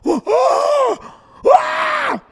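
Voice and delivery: loud, high mock gasps of shock; high-pitched horrified "oh" and "ah"; imitated crowd gasps. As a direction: gasp like a scandalized crowd, very high. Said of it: "you could hear a".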